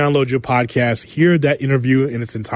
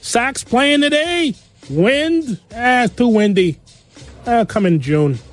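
put-on high-pitched Chicago South Side accent; 9 semitones higher than usual. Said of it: "That's a good accent".

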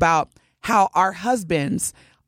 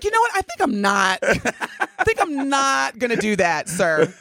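sassy waitress; 2 semitones higher than usual. Retold or imitated. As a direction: refuse him with attitude. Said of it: "She's like".

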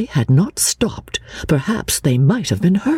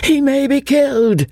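high-pitched, alarmed cry; anxious cry; 11 semitones higher than usual. A narrator's reading. Old man insisting his son is in danger, crying out high. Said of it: "cried the old man".

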